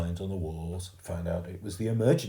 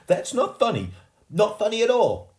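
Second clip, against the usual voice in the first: much higher, louder reproach; stern rebuke; 9 dB louder than usual. A narrator's reading. Mum telling the laughing crowd it is not funny, sharp. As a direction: scold them sharply.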